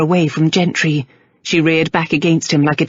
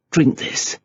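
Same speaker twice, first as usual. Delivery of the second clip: curt; 3 semitones lower, 3 dB quieter than usual. A narrator's reading. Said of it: "he said curtly".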